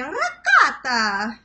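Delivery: slow Italian ricotta, crisp t in ricotta; Italian pronunciation. Shown as a demonstration. Vlogger tries how real Italians say ricotta. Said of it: "They say it nicer".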